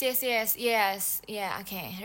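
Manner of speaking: ordinary talk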